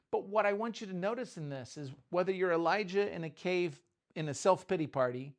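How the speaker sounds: ordinary delivery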